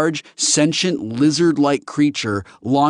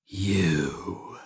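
quiet menace; 2 semitones lower, 6 dB quieter than usual. Acted. Alien realises who insulted him.

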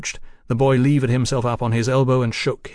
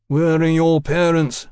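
slow, higher, stern demand; 4 semitones higher, 4 dB louder than usual. Acted. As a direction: demand an answer, drawing it out. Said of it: "Fraser demanded".

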